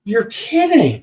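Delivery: disbelief. Real, not acted. Hearing about the hit on the husband, astonished. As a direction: take in startling gossip, incredulous.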